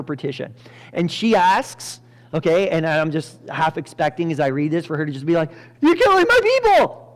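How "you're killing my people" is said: high, loud imagined plea; mock outcry. Imagined Esther protesting to the king, high mock outcry. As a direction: cry out in hammed-up outrage.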